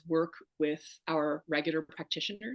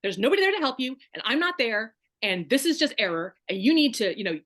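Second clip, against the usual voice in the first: loud, higher, fast harsh scolding; stern scolding; 5 semitones higher, 7 dB louder, faster than usual. Retold or imitated. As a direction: bark it at her like an annoyed practitioner. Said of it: "she was like".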